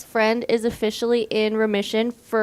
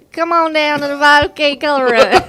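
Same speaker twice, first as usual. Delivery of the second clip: shy stab at Cajun, higher and louder; half-hearted Louisiana Cajun attempt; tentative Cajun accent; 4 semitones higher, 7 dB louder than usual. Shown as a demonstration. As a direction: try a Cajun accent, breaking into laughter. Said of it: "your best, like, Louisiana Cajun accent".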